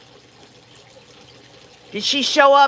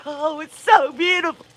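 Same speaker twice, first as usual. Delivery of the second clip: high, hammed-up sobbing gush with a cry on the oh; mock gushing lover; 5 semitones higher, slower than usual. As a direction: parody a tearful, swooning lover. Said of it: "they're both laying there going".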